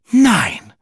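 high, loud astonished no; shocked gasp. A narrator's reading. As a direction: gasp out the no in disbelief. Wife shocked by gossip.

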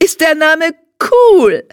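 put-on high, gushing child's voice; an impatient girl who wants to go now; high, thrilled, eager girl talking fast in joy; excited little girl. A narrator's reading.

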